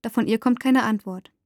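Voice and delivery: usual speaking voice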